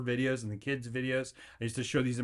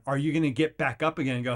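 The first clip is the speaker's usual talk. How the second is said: usual speaking voice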